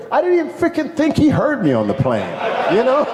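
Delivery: high, laughing through the words. Real, not acted. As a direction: laugh as you tell it. Laughing about scout surprising him.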